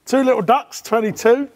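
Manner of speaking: high, loud bingo call; bingo caller. Pretend. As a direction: belt out the number to the hall. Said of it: "Always wanted to do this job".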